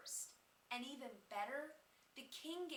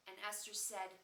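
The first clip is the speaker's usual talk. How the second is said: ordinary delivery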